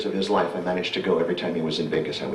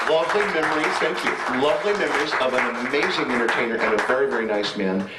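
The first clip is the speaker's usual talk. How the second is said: moved and laughing; 5 semitones higher than usual. Real, not acted. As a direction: let the laugh carry the thanks. Moved thanks after laughing.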